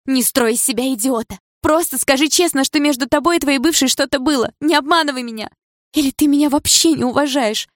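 high, loud, hurt accusation; tearful outburst. Acted. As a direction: throw it at him, close to tears. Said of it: "прошептала девушка".

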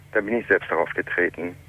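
ordinary talk

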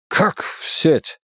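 breathless stunned question; shocked whisper. A narrator's reading. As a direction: gasp it out in disbelief.

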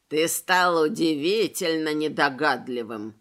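put-on low, creaky, rattling old bones; creaky rattling skeleton. A narrator's reading. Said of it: "скрипучим, дребезжащим голосом".

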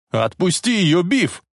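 high-pitched protesting shout. A narrator's reading. Boy orders bully to release girl, high acted shout. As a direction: call out high and urgent. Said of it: "снова закричал Марти".

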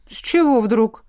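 short, puzzled question; surprised. A narrator's reading. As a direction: ask with mild surprise at the idea. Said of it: "удивился отец".

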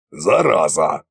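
low, short curse under modest force; low grumbled curse. Acted. Tsar thanking the narrator with a curse, low grumble.

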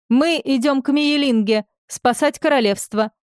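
high proud declaration. A narrator's reading. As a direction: announce it proudly. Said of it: "гордо сказал Агудал".

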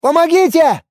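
high, loud call for help; crying out. A narrator's reading. Player dodging death, crying out. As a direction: yell for rescue in panic. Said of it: "выкрикнул я".

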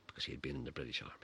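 quick whispered aside. Real, not acted. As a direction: whisper it fast behind hand.

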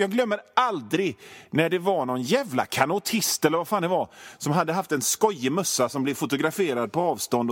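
usual speaking voice

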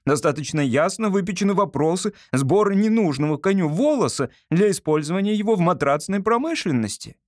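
put-on high-pitched, emphatic boy ideologue; lecturing son. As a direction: reel off slogans with total conviction. Narrator voices the lecturing son.

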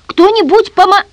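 high, loud desperate yell; panicked high-pitched cry; crying out for help. A narrator's reading. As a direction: shout for help at full voice, cut short. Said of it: "заорал в голос".

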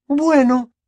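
high, loud voice of a flustered mother; reluctant mother giving in. Acted. Mother yielding to the others at the table, reluctant. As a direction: give in reluctantly, pitched high.

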